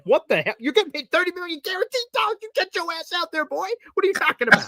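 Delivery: high, put-on outraged fan voice, hammed up; scolding like an indignant fan; mock outraged fan. Fan scolding a backup quarterback, mock outraged. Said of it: "including myself, like".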